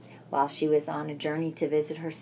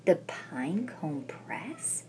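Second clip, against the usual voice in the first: slow unvoiced wondering whisper; 6 dB quieter, slower than usual. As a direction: whisper the name in awe. Name repeated in hushed wonder.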